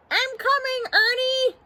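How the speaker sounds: high, panicked call. Acted. Bert rushes with a mop to Ernie, high frantic call. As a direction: holler it high to someone in another room.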